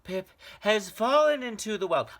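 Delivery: high, loud, fast burst; gasping boy, rushed. Acted. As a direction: blurt it out breathless. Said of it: "he gasped".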